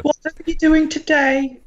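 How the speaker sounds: high, loud put-on standard British; exaggerated standard British English; standard British accent. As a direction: chirp it in a proper British accent, high-pitched. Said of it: "doing the standard British".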